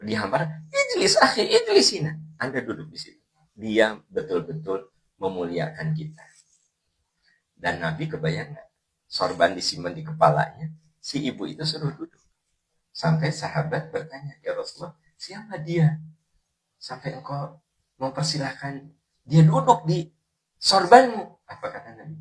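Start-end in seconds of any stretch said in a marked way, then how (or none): 0.7-2.1 very high, loud hospitable call. Acted. 14.4-21.4 higher voice, building louder to the end; astonished questioning. Retold or imitated. Companion questions the Prophet, astonished.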